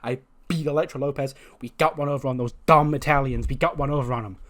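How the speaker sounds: excited, emphatic boasting; ecstatic. Acted. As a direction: gush a victory report to the teacher. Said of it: "And she's all ecstatic".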